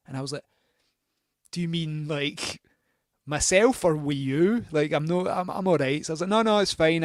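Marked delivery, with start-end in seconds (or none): 1.5-6.0 awkward loud refusal. Retold or imitated. Awkwardly refusing the landlord's offer. 6.3-7.0 loud flustered refusal. Retold or imitated. Awkward refusal to landlord.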